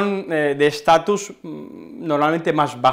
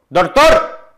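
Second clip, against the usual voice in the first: "doctor" punched out higher and louder; emphasis on doctor; 5 semitones higher, 9 dB louder than usual. Shown as a demonstration. Teacher showing students emphasis on "doctor". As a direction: hit the word "doctor" hard, like an urgent call. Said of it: "Es darle importancia a eso".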